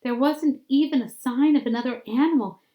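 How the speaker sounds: ordinary delivery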